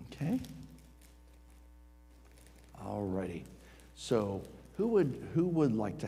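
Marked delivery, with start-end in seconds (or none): none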